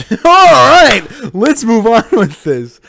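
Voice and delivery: loud, high laugh spilling into the words; laughing loudly. Real, not acted. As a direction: laugh it off and push on, slowly. Laughing after a friend's joke.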